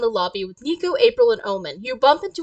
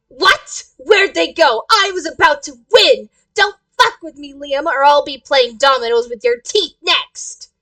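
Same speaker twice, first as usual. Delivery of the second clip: louder, indignant yelling threat; angry outburst; 3 semitones higher, 6 dB louder than usual. Acted. Player threatens Liam over stolen dominoes, angry outburst. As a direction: blow up in outrage, loud and punchy.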